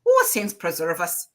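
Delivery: lightly voiced old Irish grandmother brogue; a hint of an Irish accent, aghast; mild Irish brogue, scandalised at the name. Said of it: "in her Irish brogue".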